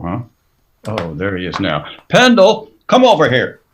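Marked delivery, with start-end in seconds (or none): 2.1-3.6 loud call across the room, much louder. Acted. Sam calling Pendle over to the marshal, loud call. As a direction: summon him from over by the door.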